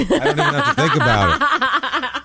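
high giddy laugh through the words; laughing gush. Real, not acted. Fan gushing about Costner, laughing giddily. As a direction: swoon and giggle at the thought.

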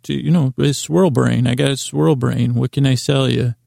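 ordinary talk, nothing marked

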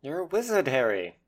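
lightly voiced storybook giant, starting high; Hagrid. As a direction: do a light impression of the wizard boy's big friend. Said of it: "spot-on Hagrid impression".

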